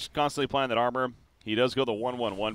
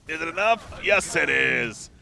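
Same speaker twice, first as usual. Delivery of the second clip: raised question, then drawn-out loud confirmation; excited play-by-play; 7 semitones higher, 6 dB louder, slower than usual. Real, not acted. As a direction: build suspense, then stretch the answer triumphantly. Calling a kill live.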